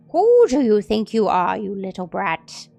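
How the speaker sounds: loud, scornful outburst; furious rebuke. Acted. Old man raging at Mikhail, loud. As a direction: tear into the brat.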